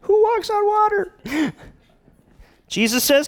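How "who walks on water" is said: very high, comic voice of disbelief; doubting inner thought. Acted. Peter privately doubts it is Jesus, comic high-pitched disbelief. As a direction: squeak out the private doubt, half laughing. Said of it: "Probably thinking".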